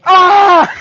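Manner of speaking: loud high mock scream of pain; retold scream. Retelling Dan getting tased, loud mock scream. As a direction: yell it like the shock just hit.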